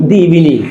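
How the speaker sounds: "the" said "dee" before evening. Shown as a demonstration. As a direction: say "the" with an ee vowel. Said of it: "এটা হবে দি".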